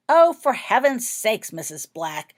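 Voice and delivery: loud, high, slow exasperated cry; exasperated exclamation. A narrator's reading. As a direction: cry out, fed up with the noise. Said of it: "exclaimed Jenny to the portrait".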